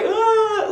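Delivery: a short high wailing "ah", hammed-up like an ugly cry; imitated ugly cry. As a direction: mimic a sudden ugly-cry wail, half laughing. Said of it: "like, ugly cry".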